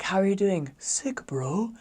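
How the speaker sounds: high, earnest greeting with slang tacked on; imitated slang misuse. English learner greets missionaries, imitated misused slang.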